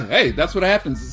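normal talking voice

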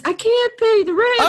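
high, pleading victim; whiny victim voice. Retold or imitated. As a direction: beg like a helpless victim. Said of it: "the victim says".

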